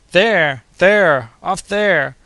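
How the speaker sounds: inhuman shriek. A narrator's reading. Doctor pointing at the coming storm, wild inhuman shriek. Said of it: "in a voice no longer human, shrieked".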